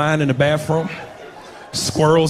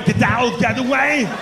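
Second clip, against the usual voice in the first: movie-hero catchphrase; 5 semitones higher than usual. Acted. Warning of an explosion in the bathroom, mock urgent. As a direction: stretch out the last word like an action hero. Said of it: "He says that in every movie".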